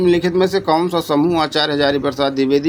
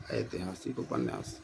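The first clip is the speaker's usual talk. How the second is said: dropped to a near-whisper, much softer; soft answer; 13 semitones lower, 17 dB quieter than usual. Shown as a demonstration. Teacher reveals the answer to students, hushed and soft. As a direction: murmur the answer as a quiet reveal.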